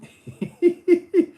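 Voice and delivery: high loud cartoon dog hyuck laugh; Goofy laugh. Streamer lets out Goofy's signature laugh, high and loud. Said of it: "that's my impression".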